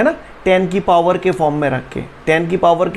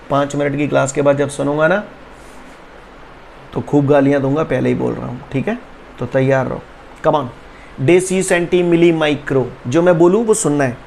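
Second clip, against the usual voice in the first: ordinary talk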